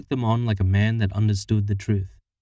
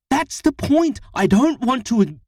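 high, loud and cut off mid-word; furious protest, stopped short; angry outburst; 6 dB louder than usual. A narrator's reading. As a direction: blurt it out in anger. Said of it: "Harriet was ready to go on a tirade".

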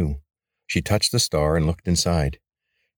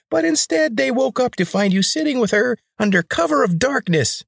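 much higher and louder, commanding; high grating rebel leader; 3 dB louder than usual. A narrator's reading. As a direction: bark orders in a high, grating voice. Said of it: "A high, grating voice shot back at Jorid".

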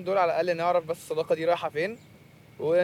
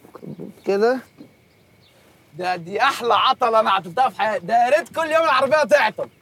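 overexcited; 6 semitones higher, 5 dB louder than usual. Acted. Gushing, overexcited fan.

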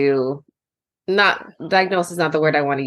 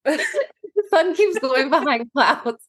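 laughing; 10 semitones higher, much slower than usual. Real, not acted. Giggling about sun and blinds.

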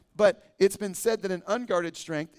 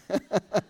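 hearty laugh; 3 semitones higher, 3 dB louder than usual. Real, not acted. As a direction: open laugh out loud. Laughs at own joke.

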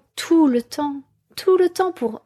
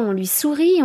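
usual speaking voice

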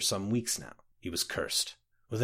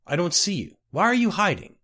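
higher, louder exasperated demand; mild frustration; 6 semitones higher, 5 dB louder than usual. A narrator's reading. Hungry lost clerk calls to a hidden voice, frustrated. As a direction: call out with rising frustration. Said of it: "In a frustration born of hunger and exhaustion".

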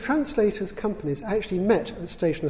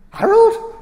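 loud, high-pitched astonished question; shocked echo, shooting up high; incredulous; 14 dB louder than usual. Retold or imitated. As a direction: blurt it out in disbelief. Disbelieving echo of a surprising answer.

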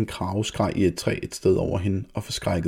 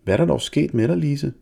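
mother asking; 7 semitones higher than usual. A narrator's reading. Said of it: "spurgte mor".

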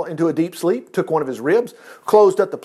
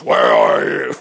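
much lower, louder booming voice of God calling out; God's voice; 4 dB louder, much slower than usual. Acted. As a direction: drop the voice way down and call out as the Lord. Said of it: "And I'll change my voice".

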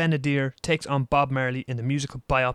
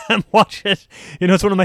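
laughing; 6 semitones higher, 5 dB louder, faster than usual. Real, not acted. Laughs about getting high.

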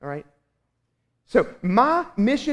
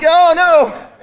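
loud dismay; 11 semitones higher, 11 dB louder than usual. Retold or imitated. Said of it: "I'm like".

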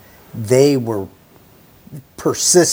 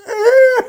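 mock groan; 4 dB louder than usual. Shown as a demonstration. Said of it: "overwhelming urge to just wanna go".

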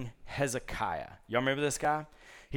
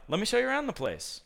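higher, fast and eager; eager host; 9 semitones higher, faster than usual. Retold or imitated. King eagerly offering a tour to the envoys. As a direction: show off the palace. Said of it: "Hezekiah's like".

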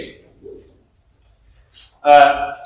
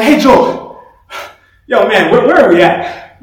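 higher, louder mock call to a running buddy; retold shout to a running partner; 4 semitones higher, 5 dB louder than usual. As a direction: call ahead to your friend, half-joking. Calling out to Joel mid-run.

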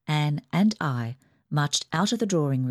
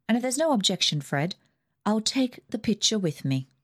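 put-on higher, imperious old aunt; Aunt Ida's voice; 5 semitones higher than usual. Rich aunt dismissing the family, higher and bossy. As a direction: lift the pitch and command like a rich aunt.

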